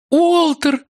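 high sobbing cry of relief. Acted. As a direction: cry his name through tears. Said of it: "всхлипывала она". (всхлипывая)

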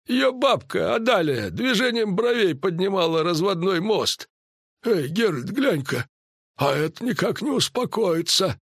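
high-pitched druid character voice, lecturing. Acted. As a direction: explain it knowingly in a high voice. (высокий голос друида, поучительно)

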